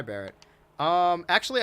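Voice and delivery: ordinary talk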